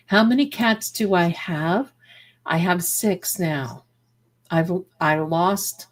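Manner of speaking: ordinary talk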